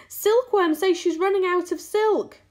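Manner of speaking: high, loud alarm call; yell. A narrator's reading. Centipede reporting silk running out, yelling. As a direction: yell it up from below. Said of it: "yelled the centipede from below".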